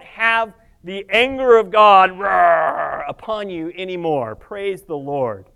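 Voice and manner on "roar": loud, drawn-out playful growl; mock angry roar. Preacher imitating God's anger, mock roar. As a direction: growl it out like cartoon wrath.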